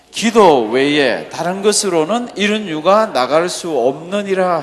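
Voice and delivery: loud, slow, declamatory Bible reading; scripture reading. Acted. Preacher leading the congregation in reading, loud scripture recitation. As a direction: lead the congregation through the verse, loudly and slowly.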